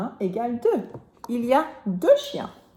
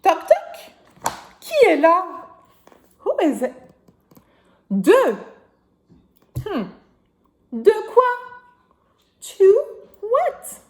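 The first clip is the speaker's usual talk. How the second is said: knock knock voice; 7 semitones higher, 7 dB louder than usual. Acted. Knock-knock game, loud.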